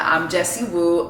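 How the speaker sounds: usual speaking voice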